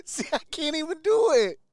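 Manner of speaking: high, quick, laughing at yourself; laughing admission. Real, not acted. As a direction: squeal it through a laugh, voice giving out. Laughing at own failed woo.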